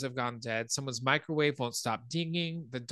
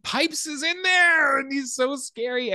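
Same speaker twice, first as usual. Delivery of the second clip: loud, high, drawn-out squeal of gleeful fright; thrilled shriek; 13 semitones higher, 8 dB louder than usual. Real, not acted. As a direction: shriek the scary reveal, holding it high and long. Thrilled retelling of scary reveal.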